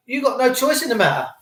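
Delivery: ordinary talk, nothing marked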